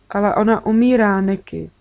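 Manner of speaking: ordinary delivery, nothing marked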